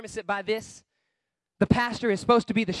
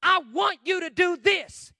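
loud, high, slow command; forceful, measured demand; blunt pastor's order; 5 semitones higher, 9 dB louder, slower than usual. Acted. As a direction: drive every word home loudly. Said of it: "so the pastor can say".

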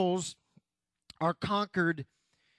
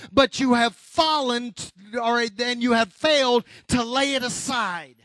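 raised, driving preacher's voice; forceful preaching; 4 semitones higher, 6 dB louder than usual. Real, not acted. Preacher rebuking congregation for old hang-ups, forceful preaching.

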